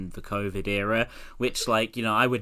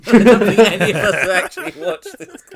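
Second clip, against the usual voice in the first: laughing, much higher and louder; 11 semitones higher, 15 dB louder than usual. Real, not acted. Admitting to the hosts nobody watched it, laughing loudly. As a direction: blurt it out through laughter. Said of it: "laughing admission".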